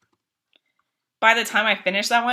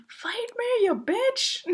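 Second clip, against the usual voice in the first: mock whisper; 13 semitones higher, 8 dB quieter, slower than usual. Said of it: "I was channeling Chili".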